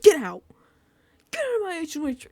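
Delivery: hammed-up order to clear out; mock furious shout. Imagining her throwing out a visitor, mock angry shout.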